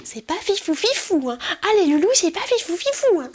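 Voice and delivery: very high, loud mock squeal; cutesy vlogger voice. Vlogger telling the loulous it's not great, squeaky mockery. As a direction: chirp the catchphrase in a silly high voice.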